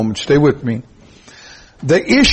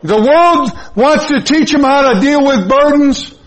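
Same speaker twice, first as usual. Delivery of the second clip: full-force preaching; 10 semitones higher than usual. Acted. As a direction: proclaim it to the rafters.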